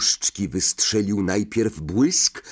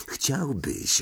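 softer, furtive retort; out the corner of the mouth; 3 semitones higher, 6 dB quieter than usual. A narrator's reading. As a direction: slip it out under your breath. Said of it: "odpowiedział Harry kącikiem warg".

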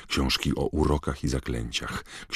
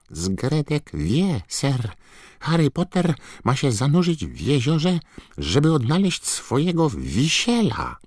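high, squeaky elf voice; Dobby house-elf voice; 12 semitones higher, 4 dB louder than usual. A narrator's reading. Dobby explaining. (wysoki, piskliwy głos skrzata)

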